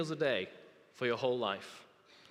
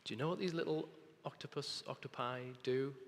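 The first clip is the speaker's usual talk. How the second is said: usual speaking voice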